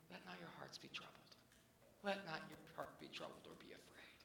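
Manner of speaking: soft hushed whisper; whispered scripture. Acted. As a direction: breathe the comfort out gently. Quoting Jesus' words of peace.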